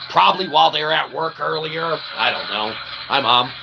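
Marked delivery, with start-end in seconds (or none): none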